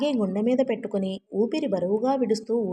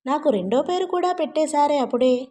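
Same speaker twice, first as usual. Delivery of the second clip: plain reading voice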